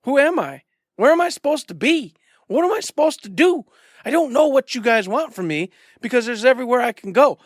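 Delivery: high-pitched, bewildered player pleading; hammed-up lost and overwhelmed; flustered confusion. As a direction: plead helplessly with the game characters. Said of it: "And I'm like".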